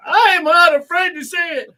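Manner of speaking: high, loud proclamation; mock-defiant shout. Host proudly confessing a taste for light beer, mock-defiant shout. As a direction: belt out a playful confession.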